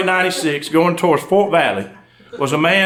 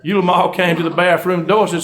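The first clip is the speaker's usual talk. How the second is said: normal talking voice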